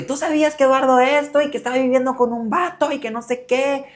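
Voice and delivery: put-on a drunk grandfather's tearful reproach; mock drunk grandpa. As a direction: throw the blame at her, tearful and drunk. Grandpa's drunk accusation, retold.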